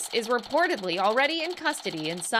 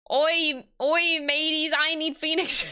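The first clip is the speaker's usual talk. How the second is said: higher-pitched attempt at a pirate; 5 semitones higher, 3 dB louder, slower than usual. Streamer trying to voice a pirate client, mock pirate. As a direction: have a go at hailing the mateys like a pirate. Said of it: "how does one speak in pirate?".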